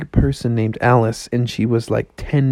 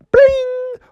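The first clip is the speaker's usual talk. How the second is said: high-pitched page-turn bling; high sung-out bell noise; imitated chime. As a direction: ring out like a little chime.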